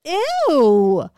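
re-enacted grossed-out reaction; undisguised disgust. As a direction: recoil openly, no poker face. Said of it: "no poker face".

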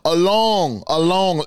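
usual speaking voice

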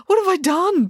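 high-pitched burst of panic; panicked. A narrator's reading. Dad reacts to being pointed at by April, panicked. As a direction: blurt it out in high-pitched alarm. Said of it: "panicked Dad".

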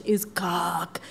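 whispered confession; sheepish whispered aside. Real, not acted. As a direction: drop to a whisper. Admits bad accent.

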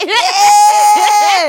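high burst of laughter; loud laugh. Real, not acted. Laughing at own joke.

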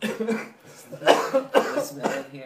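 very high, slow, breathy squeal; quoting the farting skit through laughter; squeaky mock quote. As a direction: stretch it out high and airy.

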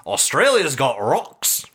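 practised Australian catchphrase; brief demo of an Australian accent. Shown as a demonstration. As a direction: show off your one Aussie line. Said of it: "The only phrase I can say with an Australian accent".

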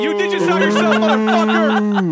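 loud playful shout. Real, not acted. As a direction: yell it out at a friend. Teasing friend on podcast.

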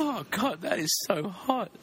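put-on high swooning girly voice; girl voice. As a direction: gush over him like a smitten girl. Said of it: "I'm a girl".

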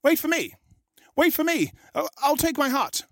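high monkey calling out as he climbs; monkey calling down to the shark, high. A narrator's reading. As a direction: sing out high, wait for me.

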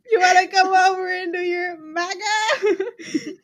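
high mocking quote through giggles; laughing mockery. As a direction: repeat it teasingly, cracking up. Mocks friend's pun while laughing.

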